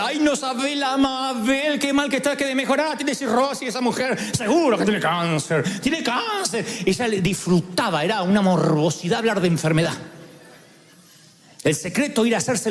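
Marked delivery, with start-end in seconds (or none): 0.1-6.6 higher, slowed, complaining sick-aunt voice; whiny aunt. Retold or imitated. The tearful aunt listing her illnesses to the speaker, whiny high complaint. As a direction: moan about her ailments like the gloomy aunt. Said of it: "hablaba de enfermedad".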